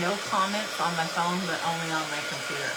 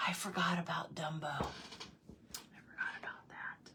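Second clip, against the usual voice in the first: whisper; 10 dB quieter than usual. Real, not acted. Remembering the Dumbo scene, whispering. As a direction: whisper it as the sad memory returns.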